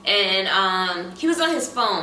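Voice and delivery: ordinary talk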